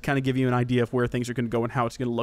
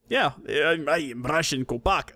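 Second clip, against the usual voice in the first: hammed-up bad Russian accent; horrible Russian accent. Host clowning as a Russian Kopaka, horrible Russian accent. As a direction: attempt a Russian accent and botch it. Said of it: "That's a horrible Russian accent".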